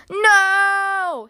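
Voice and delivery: long, high, loud No; horrified scream. A narrator's reading. Grumpy Badger wakes from dreaming Mole falls, screaming. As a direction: wail it out as if waking from a nightmare. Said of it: "screamed Grumpy Badger".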